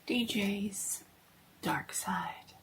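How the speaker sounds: hushed, half-whispered horror-show intro; spooky host whisper. Acted.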